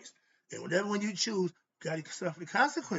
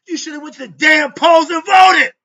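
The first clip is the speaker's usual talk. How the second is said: much higher, full-volume angry yell; furious shout; 10 semitones higher, 23 dB louder than usual. Real, not acted. Scolding people who skipped the polls, a furious shout. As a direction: blow up at them. Ranting at marchers who didn't vote.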